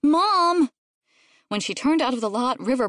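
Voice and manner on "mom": high, louder cry; insistent kid's shout; child calling out. A narrator's reading. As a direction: yell for Mom urgently. Child calls to mother.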